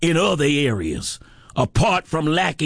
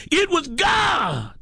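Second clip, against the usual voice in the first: high, slow, shouted declaration; triumphant shout; 11 semitones higher, slower than usual. Acted. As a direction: proclaim it to the rafters. Sermon refrain, loud then low.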